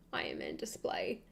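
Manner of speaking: whispered aside, voice dropped away. Real, not acted. Whispering to herself mid-sentence about her display. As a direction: breathe it under your breath.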